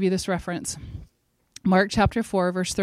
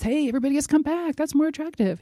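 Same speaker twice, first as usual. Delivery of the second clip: higher, fast, airy mock call to the crowd; mocking breezy invitation; 8 semitones higher than usual. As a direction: toss it off like a flippant recruiter, much higher. It wasn't this.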